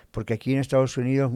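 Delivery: ordinary delivery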